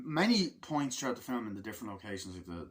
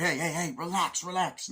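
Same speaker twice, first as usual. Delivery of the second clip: high, louder urgent calming; mock calming shout; 7 semitones higher, 5 dB louder than usual. Soldier breaking up the argument, loud mock call. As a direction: break up the fight. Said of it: "It's like".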